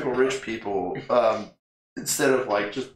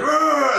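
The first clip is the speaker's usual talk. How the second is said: high, loud mock roar; T-Rex roar; 13 semitones higher, 5 dB louder than usual. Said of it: "He's like".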